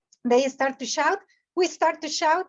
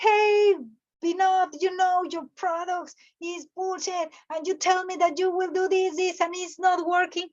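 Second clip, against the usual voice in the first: higher-pitched, acted irate complaint; angry client; 4 semitones higher than usual. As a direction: complain like a furious customer on a call. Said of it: "If angry client called you and say".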